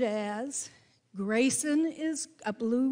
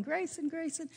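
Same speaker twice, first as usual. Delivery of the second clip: calling out a name; 6 semitones higher, 5 dB quieter than usual. Retold or imitated. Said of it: "looking around and calling".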